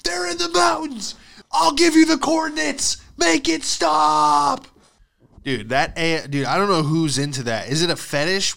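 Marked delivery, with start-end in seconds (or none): 0.0-4.7 very high hammed-up scream, building louder; panicked screaming. Tortured prisoner gives up his squad, screaming in mock panic. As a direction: shriek it out, stretching the final plea.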